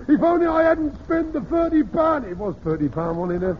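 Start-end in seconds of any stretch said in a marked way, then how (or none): 0.1-2.2 high, loud imitation of a cry in sleep; mock sleep-talker cry. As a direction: imitate the son crying out in his sleep, much higher. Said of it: "he cried out".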